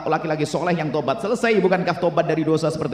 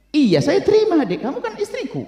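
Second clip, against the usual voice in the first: high-pitched, emphatic reassurance; insistent husband. Acted. Husband insisting he accepts his wife, high and emphatic. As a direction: push the yes up high, exasperated but loving.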